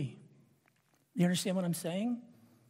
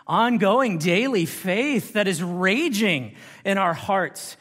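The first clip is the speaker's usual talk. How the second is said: raised, loud, insistent pulpit delivery; forceful preaching; 4 semitones higher, 8 dB louder than usual. Real, not acted. Preacher challenging listeners about the battle for faith, forceful. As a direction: press each phrase home with a raised voice.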